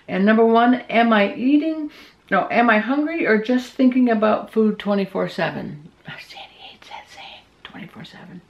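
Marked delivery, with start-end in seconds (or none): 6.1-8.4 soft unvoiced whisper, a quick aside. Real, not acted. Whispering an aside about Sandy's pet peeve. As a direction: drop to a whisper for a private joke.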